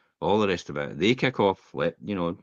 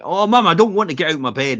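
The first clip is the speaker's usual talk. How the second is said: put-on higher, louder whining kid voice; whiny child; 8 semitones higher, 8 dB louder than usual. Boy whining to his mum about getting up, loud and high. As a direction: whine like a boy who won't get up. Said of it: "And he was like".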